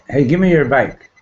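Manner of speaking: a pushy, offhand grab for a favor; demanding. Retold or imitated. To a friend, rudely demanding his bike, mock-demanding. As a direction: toss it off like you're owed it. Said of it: "You don't demand".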